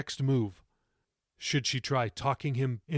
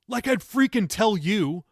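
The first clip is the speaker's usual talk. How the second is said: high, loud scornful retort; teen sneering at his grandmother; contemptuous retort; 8 semitones higher, 7 dB louder than usual. A narrator's reading. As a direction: throw it back with contempt.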